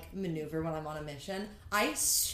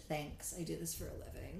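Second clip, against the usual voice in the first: smug quiet; 3 semitones lower, 10 dB quieter than usual. Retold or imitated.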